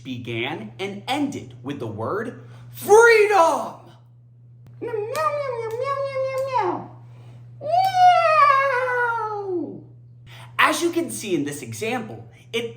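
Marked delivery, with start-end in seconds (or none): 2.7-3.8 freedom belted out high and loud; emphatic freedom. Shown as a demonstration. President's speech quote demo. 4.8-6.9 very high, rapid cat meows; playful cartoon cat meows; meowing cat. Goofy cat interlude. 7.6-9.8 long, high, loud yowling meow; drawn-out crying cat yowl; wailing cat meow. Pretend. Cat wail ending.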